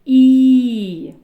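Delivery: long «І» sung out loud. Shown as a demonstration. Teacher showing the vowel to children, loud. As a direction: stretch «І» loud and clear. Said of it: "над".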